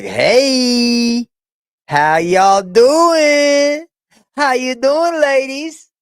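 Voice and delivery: high, slow flirty voice for the cat; cat's smooth flirt. Pretend. Voicing the cat greeting female viewers, flirty. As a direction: drawl it like a ladies' man. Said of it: "he's looking at you ladies like".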